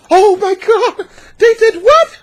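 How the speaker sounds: shocked disbelief. Acted. Wife reacting to husband being kicked out, shocked high shriek. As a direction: gasp it out, scandalized.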